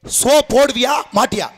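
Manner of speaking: slow, measured ultimatum; drawn-out, insistent question; final-warning demand. Acted. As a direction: spell it out slowly as a last warning. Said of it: "இறுதி என்னோட தடவை கேக்குறேன்".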